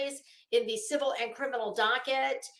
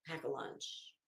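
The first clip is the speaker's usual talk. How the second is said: whispered aside; 9 semitones lower, 16 dB quieter than usual. Real, not acted. To her viewers about the coming discovery, a sly whisper. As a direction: lean in and breathe it as a private tip.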